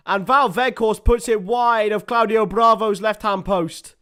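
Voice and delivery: high, loud shout of disbelief at a miss; excited commentary. Real, not acted. Commentator reacting to Weghorst missing a sitter, excited and exasperated shouting. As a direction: build to a stretched cry, then stay loud and high.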